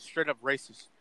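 ordinary talk

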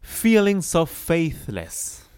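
slow quoted lyric; slow lyric read. Shown as a demonstration. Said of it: "Then he says".

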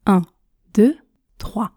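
ordinary talk

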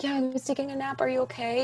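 high, soft, drowsy worry; sleepy concern. Retold or imitated. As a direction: mimic a groggy friend asking if all is well. Friend woken from a nap.

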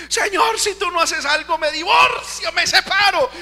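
high, loud mock plea to God that ends in a shout; mocking desperate prayer. Pastor imitating a believer begging God, high and loud. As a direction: ham up a churchgoer threatening divorce.

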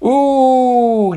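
high teasing ooh. A narrator's reading. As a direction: tease him with a long ooh. Teasing reaction.

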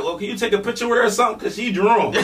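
hurried mom. Retold or imitated.

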